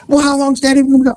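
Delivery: high, quick imitation of a child; inquisitive little girl. Little girl quizzes officer about Daddy, insistent. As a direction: quiz him like a pushy kid, fast and high.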